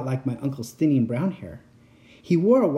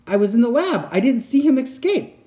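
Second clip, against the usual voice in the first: alarmed; 10 semitones higher, 6 dB louder than usual. Acted. Excited voice continues.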